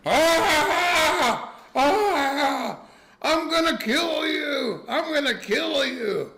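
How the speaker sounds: top-of-the-lungs threat, dragged out; raging scream. Retold or imitated. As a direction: scream it like a huge man about to charge. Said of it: "starts screaming at the top of his lungs".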